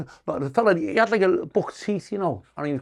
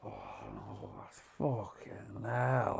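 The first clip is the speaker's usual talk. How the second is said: groaning to himself; 7 semitones lower, 17 dB quieter, much slower than usual. Retold or imitated. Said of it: "and I go".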